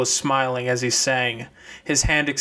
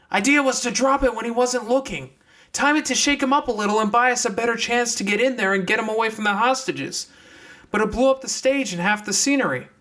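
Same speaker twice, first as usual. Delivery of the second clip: higher, quick explanation; fox explaining on the run; 9 semitones higher than usual. Acted. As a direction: explain while running. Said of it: "the fox said as she ran".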